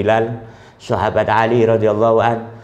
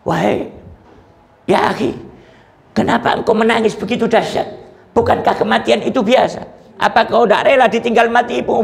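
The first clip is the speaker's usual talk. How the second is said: a lightly voiced friend, raised and pressing; questioning friend; 8 semitones higher than usual. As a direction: friends pushing him about his grief. Friends question the weeping tabi'in. (sahabat bertanya dengan nada agak tinggi)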